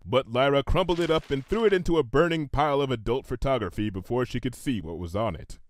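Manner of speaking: usual speaking voice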